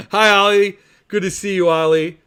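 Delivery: warm greeting. Real, not acted. Host greeting a young viewer in the chat, warmly.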